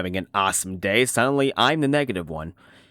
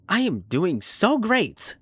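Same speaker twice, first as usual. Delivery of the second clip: slow, higher, overdone cheeriness; put-on cheer; 7 semitones higher, much slower than usual. Answering people who ask how he's doing, falsely cheery. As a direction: drag it out with fake enthusiasm. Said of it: "I usually just say".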